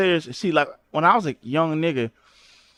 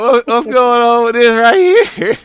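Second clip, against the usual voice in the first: higher and louder, laughing through it; laughing mock question; 6 semitones higher, 6 dB louder than usual. Streamer repeats his question while laughing at the photo. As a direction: ask it while cracking up.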